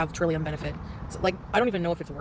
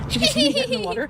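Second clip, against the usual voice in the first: silly high voice; 5 dB louder than usual. Pretend. Joking to camera on walk.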